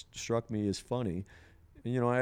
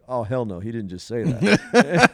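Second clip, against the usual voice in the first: loud, outraged mock retort with the last words dragged slow; sassy ego voice; 3 semitones higher, 12 dB louder, slower than usual. His ego firing back at being told off, loud mock outrage. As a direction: play his ego, scandalized and shouting back. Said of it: "my ego almost kinda said".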